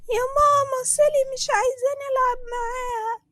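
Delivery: put-on very high, loud tearful child voice; little girl complaining to mom that Sally won't play; whiny little girl. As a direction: tattle to mom in a tiny whine.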